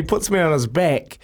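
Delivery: ordinary talk, nothing marked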